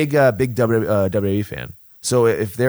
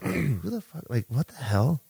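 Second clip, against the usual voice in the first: muttered thought; 3 semitones higher, 7 dB quieter than usual. Retold or imitated. Baffled inner thought.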